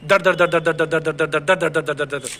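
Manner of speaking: rapid-fire monotone "दर, दर, दर"; machine-gun run of identical "दर" beats; even staccato rhythm. Shown as a demonstration. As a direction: imitate Spanish rhythm with evenly timed दर. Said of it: "Spanish कैसे चलती है".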